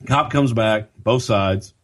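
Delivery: ordinary talk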